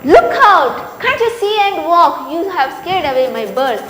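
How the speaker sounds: indignant telling-off; angry scolding. Acted. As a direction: scold someone sharply for blundering in. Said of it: "she got angry".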